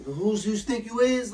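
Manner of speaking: high, slow, badly faked Brooklyn accent. Imitating his aunt from Brooklyn going off, badly faked Brooklyn accent. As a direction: have a clumsy go at a Brooklyn accent. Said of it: "I can't do a Brooklyn accent".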